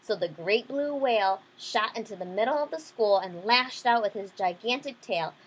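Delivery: usual speaking voice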